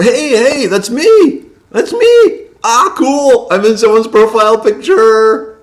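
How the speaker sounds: host shouting with delight at chat's profile picture, thrilled high yell; excited high yelp. Real, not acted. Host spotting himself in a viewer's picture, delighted shout.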